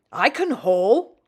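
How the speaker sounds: slow, loud bragging; boastful. A narrator's reading. Lorry boasting to Rusty, slow loud brag. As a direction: show off your strength. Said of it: "boasted Elizabeth".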